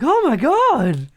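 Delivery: usual speaking voice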